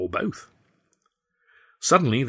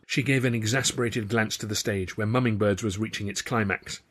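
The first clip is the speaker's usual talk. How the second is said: ordinary delivery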